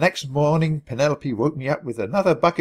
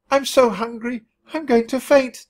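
high, loud whiny wail; 10 semitones higher, 4 dB louder than usual. A narrator's reading. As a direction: wail it like a starving mouse. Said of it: "Tubby wailed".

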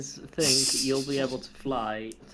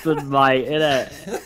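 loud, hammed-up bogan Aussie; broad bogan accent, close to laughing; 9 dB louder, slower than usual. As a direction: belt it out in thick bogan. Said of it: "did a really bogan accent".

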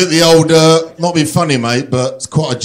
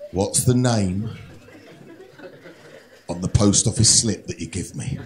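suspenseful host; 6 semitones lower, 9 dB quieter than usual. Acted. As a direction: ask it like a tense game-show host. Host asks the deciding question.

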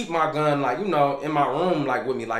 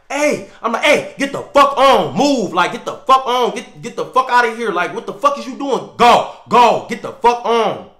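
angry shout; 7 semitones higher, 8 dB louder than usual. Retold or imitated. Man shouting at an intruder at his door, angry yell. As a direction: yell the stranger off your door.

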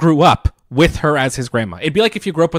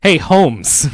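loud, higher put-on greeting; imitating Fry's slang; 6 semitones higher, 7 dB louder than usual. Leela mimics Fry to a salesman, loud put-on slang. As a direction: call out in imitation of his slang. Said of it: "I'll talk like him".

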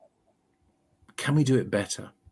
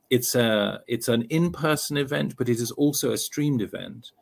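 usual speaking voice

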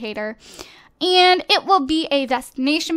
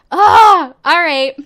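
choked up frustration; 2 semitones higher, 12 dB louder, slower than usual. Real, not acted. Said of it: "I can't even speak 'cause I'm so mad".

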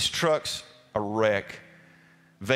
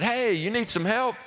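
raised, higher call across the road; 8 semitones higher than usual. Retold or imitated. Pastor calls out to stranded driver, a raised friendly shout.

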